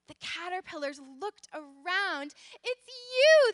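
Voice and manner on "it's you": high, loud burst of recognition; joyful cry. A narrator's reading. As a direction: exclaim it like delighted story characters. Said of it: "they cried".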